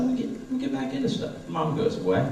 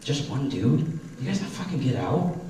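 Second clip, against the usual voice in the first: ordinary talk, nothing marked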